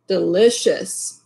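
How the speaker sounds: usual speaking voice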